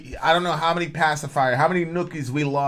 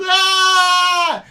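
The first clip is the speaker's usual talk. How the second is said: sudden high mock wail of a crying baby; hammed-up baby cry, high and loud; 14 semitones higher, 7 dB louder than usual. Imitating baby crying in car.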